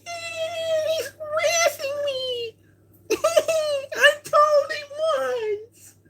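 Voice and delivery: mock a woman crying harassment, half laughing; mocking woman's voice.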